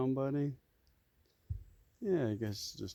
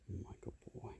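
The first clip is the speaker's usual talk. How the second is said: much softer whispered aside; 12 dB quieter than usual. Real, not acted. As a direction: barely breathe the words. Whispering to a donkey.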